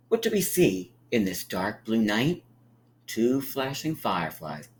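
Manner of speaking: sing-song picture-book read; gentle storybook narration; reading to children. A narrator's reading. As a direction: read it like storytime for little ones.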